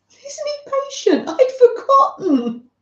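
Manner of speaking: high, sing-song voice for the cat; pet talk to cat. Real, not acted. Cooing high at the cat who reminded her.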